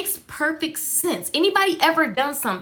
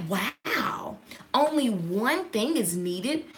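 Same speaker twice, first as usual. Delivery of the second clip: hushed, slow wondering whisper; 2 semitones lower, 7 dB quieter, much slower than usual. Retold or imitated. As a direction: breathe it in awe.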